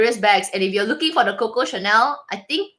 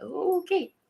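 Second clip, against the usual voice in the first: mock meek reply; 8 semitones higher, 7 dB quieter than usual. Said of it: "I said".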